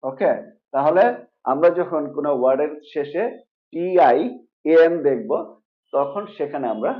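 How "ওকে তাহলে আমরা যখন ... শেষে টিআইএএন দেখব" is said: usual speaking voice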